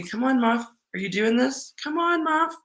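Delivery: high, sing-song coaxing of a little dog. Real, not acted. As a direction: coax the dog in a pet voice.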